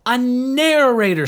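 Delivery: slow, high, loud eureka cry; drawn-out loud high scream of joy. A narrator's reading. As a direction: shout it like a discovery. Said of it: "screamed Asha".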